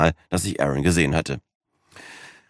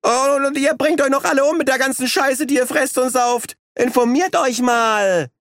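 raging. Acted. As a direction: rage at the whole party. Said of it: "tobte Aaron".

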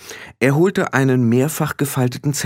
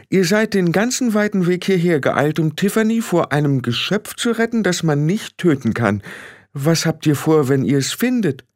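lightly voiced questioning woman, higher pitch; sceptical Frau Grad; 8 semitones higher than usual. Frau Grad questions the little men about their plan, sceptical.

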